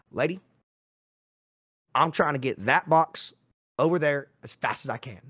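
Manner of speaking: lightly attempted Southern Arkansas accent, to the point. Quoting the old Walmart boss's brilliant answer, rough Arkansas accent. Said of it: "that's my Arkansas accent".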